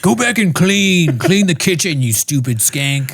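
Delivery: high, slow sneering husband; mock abusive husband. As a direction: spit it at the wife like a petty tyrant. Voicing abusive husband Tom.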